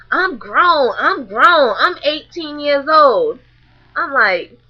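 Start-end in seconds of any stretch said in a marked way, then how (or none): 0.1-3.4 lightly voiced sassy teen, slow on the last line; mocking a bratty girl. A hint of a teen girl mocked to the audience. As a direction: mimic her insisting she's grown. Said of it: "She's like".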